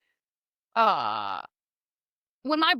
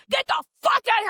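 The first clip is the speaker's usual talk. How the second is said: put-on loud, fast and higher, yelling at the kids; Southern crazy yell; 9 semitones higher, 5 dB louder, faster than usual. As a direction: yell them off the stairs. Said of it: "I immediately go Southern crazy".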